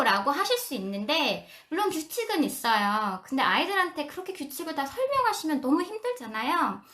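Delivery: normal talking voice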